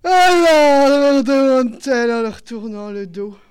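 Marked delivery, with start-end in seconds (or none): 0.0-1.2 a long loud yawned alors; yawned word. A narrator's reading. The widow turning away, yawning her question loudly. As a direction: yawn through it.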